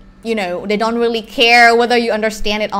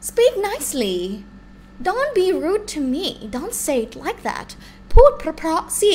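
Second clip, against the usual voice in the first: scolding command; 6 semitones higher than usual. Retold or imitated. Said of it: "พูดเพราะๆสิ is like".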